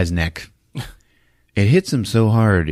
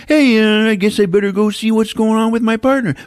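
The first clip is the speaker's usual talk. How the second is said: higher, louder dopey cop voice; lightly voiced tough cop; mock Dolph Lundgren; 13 semitones higher, 4 dB louder than usual. Dolph deciding to check on his partner, higher dopey voice.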